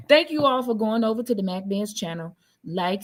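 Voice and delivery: usual speaking voice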